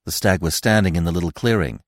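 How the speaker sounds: ordinary delivery, nothing marked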